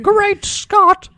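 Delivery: loud, high-pitched cry of astonishment; Emmett Brown. Acted. Quoting the movie's inventor to co-host, high astonished shout. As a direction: exclaim wildly, way up high. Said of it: "Emmett Brown is like".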